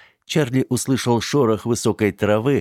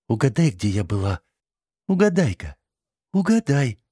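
mocking singsong; 6 semitones higher than usual. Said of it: "Глумливо полусказала, полупропела она".

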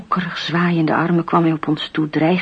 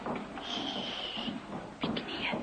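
slow menacing whisper; a hissed threat from the shadows; 16 dB quieter, much slower than usual. Acted. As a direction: breathe the order out, slowly. Menacing command in the dark.

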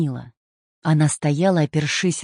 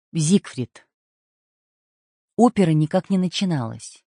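ordinary delivery, nothing marked

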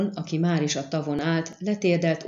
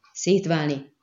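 ordinary delivery

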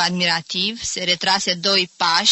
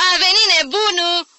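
much higher, louder, shrill joking shout; sharp humorous voice; 11 semitones higher, 6 dB louder than usual. A narrator's reading. Visiting aunt joking at the door, sharp high call. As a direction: announce him with a sharp joke. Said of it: "un glas ascuțit zise cu umor".

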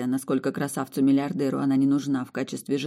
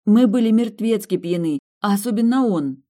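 pleading, raised and higher; insistent; 7 semitones higher, 7 dB louder than usual. Acted. Woman assuring her fiancé nothing happened, anxious and insistent. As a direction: press it hard, anxious to convince.

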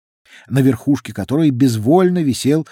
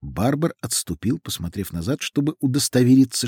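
plain reading voice

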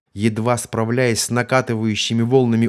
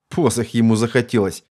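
ordinary delivery, nothing marked

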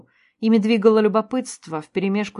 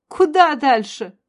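high, slow scornful retort; scornful snort; 6 semitones higher, 4 dB louder, slower than usual. A narrator's reading. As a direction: snort it back. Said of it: "фыркнула Зайна". (презрительно фыркнув)

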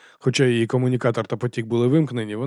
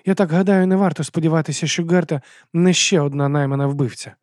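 higher-voiced female character; hopeful woman asking; 5 semitones higher, 3 dB louder than usual. A narrator's reading. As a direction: venture a worried guess in a raised voice. Abena voices her suspicion.

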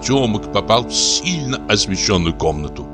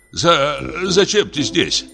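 startled; 8 semitones higher, slower than usual. Acted. Startled old actor finds Nikitushka.

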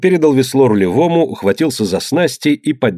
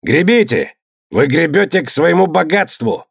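rousing shout; 6 semitones higher than usual. A narrator's reading. Tjor urging rowers.

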